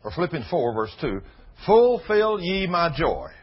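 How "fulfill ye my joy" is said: slow, loud reading of scripture. Acted. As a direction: lean into each word of the verse. Reads scripture slowly.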